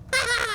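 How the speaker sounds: high, loud imitated yelp; mock yelp of pain. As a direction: squeal like the little brother being pulled. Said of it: "brother would just be going".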